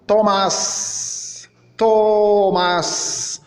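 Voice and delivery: "Thomas" with a long hissed final s; final S pronounced. Shown as a demonstration. Teacher showing the final S is read, pronounced clearly. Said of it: "doit être lu et prononcé comme".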